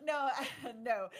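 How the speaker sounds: high, loud protest through laughter; real laughter breaking up the words; laughing denial. Real, not acted. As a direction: laugh hard while saying no. Laughing after a joke about editing.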